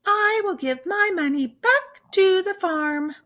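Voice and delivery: a high, lilting storybook sheep; sheep character. Acted. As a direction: play the generous little sheep. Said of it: "Shayna Sheep says".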